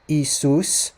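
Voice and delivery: "Isus" drawn out as ee-SOOS; Greek pronunciation. Shown as a demonstration. Teacher contrasts it with what he said, Greek pronunciation. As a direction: say "Isus" with s sounds, no sh. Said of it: "the way that it's pronounced in Greek".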